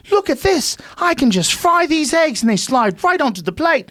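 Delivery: high-pitched English infomercial pitchman; proper English accent. Acted. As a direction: sell it excitedly in a hammed-up English accent. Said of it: "some man with a proper accent".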